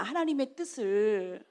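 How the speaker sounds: ordinary talk, nothing marked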